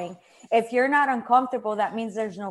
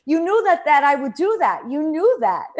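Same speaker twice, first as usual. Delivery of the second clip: playful spirit grandmother, louder; 6 semitones higher, 7 dB louder than usual. Pretend. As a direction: voice the teasing grandmother. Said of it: "She's like".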